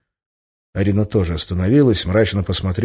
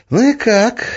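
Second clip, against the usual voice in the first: high, louder eager question; genuine interest; 5 dB louder, slower than usual. A narrator's reading. Witch asks how the bet went, genuinely interested. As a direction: ask with real curiosity, pitched up. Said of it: "В голосе Арины был неподдельный интерес".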